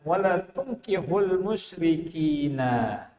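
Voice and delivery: slow chanted reading of the verse; measured Arabic verse recitation; slow Quranic recitation. Shown as a demonstration. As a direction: recite it slowly like scripture. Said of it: "di baris berikutnya".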